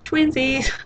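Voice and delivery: high, put-on sassy Twinsies; mock Kurt. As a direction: squeal it like Kurt imitating. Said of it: "and Kurt's like".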